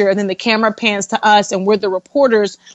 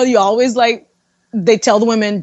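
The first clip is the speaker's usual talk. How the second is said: ordinary talk, nothing marked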